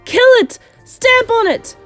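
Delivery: high, loud frenzied yells; screamed kitchen commands; shouting. A narrator's reading. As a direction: urge the mob on to attack. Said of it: "they were shouting".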